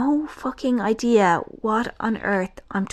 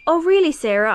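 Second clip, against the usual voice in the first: mock cheery reply; 7 semitones higher, 6 dB louder, faster than usual. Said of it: "I'm not gonna say".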